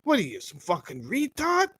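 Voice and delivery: hammed-up fatherly outburst; a hint of a furious father telling off his kid; imitating an angry father. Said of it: "the same way your father would call you out and yell at you".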